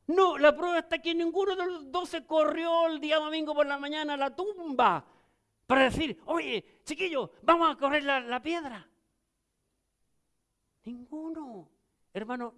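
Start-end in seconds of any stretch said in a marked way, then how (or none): 0.1-8.9 raised, emphatic sermon voice; preaching. Acted. Pastor challenges the congregation, a higher and more emphatic preaching voice. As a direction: press the point on the church. Preacher drives home a point.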